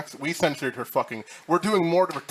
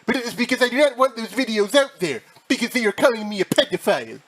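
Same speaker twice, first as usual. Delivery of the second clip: imitating Denox; 10 semitones higher, 5 dB louder than usual.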